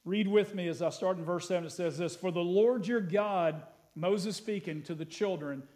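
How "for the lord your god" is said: scripture reading. Acted. Starts the text reading.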